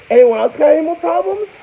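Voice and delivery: retelling how he dared the class after throwing her out, hammed-up high taunt; mock tough challenge. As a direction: taunt the whole class like a proud bouncer. Daring the class after ejecting girl.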